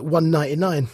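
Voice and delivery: ordinary talk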